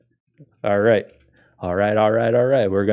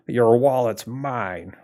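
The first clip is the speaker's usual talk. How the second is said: slow, drawn-out tough-guy threat; gangster voice; 3 semitones higher, slower than usual. Acted. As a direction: menace it like a movie gangster. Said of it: "I said it in a gangster".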